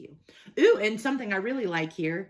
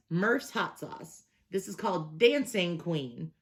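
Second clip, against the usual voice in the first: ordinary delivery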